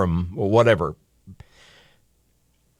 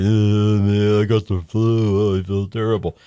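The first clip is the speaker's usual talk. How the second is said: hammed-up sick patient groan; mock sick moaning. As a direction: act out feeling miserable with the flu. Mocking a sick person in bed.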